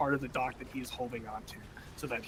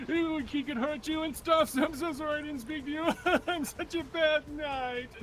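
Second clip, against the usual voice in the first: high, wailing fake tears; hammed-up sobbing apology; mock crying apology; 12 semitones higher than usual. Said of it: "I start crying".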